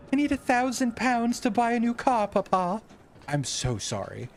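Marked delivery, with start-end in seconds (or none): 0.1-2.8 put-on high, whiny begging like a spoiled child; mock spoiled kid. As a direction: wheedle for money, pitched well up.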